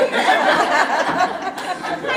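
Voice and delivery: a high, loud embarrassed laugh; real choked-up laugh; teary laugh. Real, not acted. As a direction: laugh off the tears welling up. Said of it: "I'm gonna cry".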